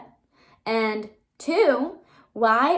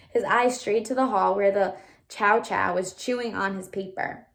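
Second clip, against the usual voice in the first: plain reading voice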